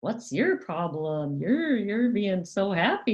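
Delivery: mock a child's happiness, snidely. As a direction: imitate a sneering parent. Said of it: "in this snide way".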